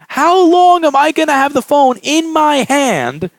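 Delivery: high, slow, drawn-out disbelief; incredulous restating. Retold or imitated. Repairer mocking the customer's question, slow high incredulity. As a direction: stretch each word in exasperated mockery.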